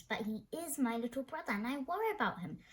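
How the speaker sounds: plain reading voice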